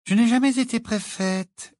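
high, light, merry voice; cheerful young witch. A narrator's reading. Witch chatting behind the boy about prefects, cheerful. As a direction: toss it out happily, pitched high. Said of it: "dit joyeusement Tonks".

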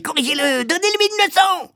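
very high screech, egging on; shrieking. A narrator's reading. Woman demanding the boy be punished, shrieking. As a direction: shriek it in frantic excitement. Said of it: "hurlait-elle".

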